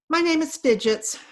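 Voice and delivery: Fidgets grunts her name, high and loud; gruff grunt, pushed up and out. A narrator's reading. Fairy grunts her name at the dog, gruffly.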